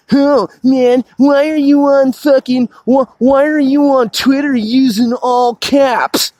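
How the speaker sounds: high, whiny mock voice of a naysayer; mocking critics. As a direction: imitate a griping critic, drawing out the last words. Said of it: "those critics and cynics and".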